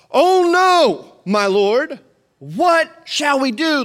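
high, loud hammed-up panic; overdramatic reading. Shown as a demonstration. Preacher hamming up the servant's line for the congregation. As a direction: ham it up like a bad stage actor. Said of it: "I read that horribly because that's in no way what it sounded like".

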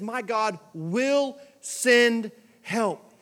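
slow, weighty stress on send; slow and emphatic. Shown as a demonstration. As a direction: lean on "send", drawn out and sure. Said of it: "He says".

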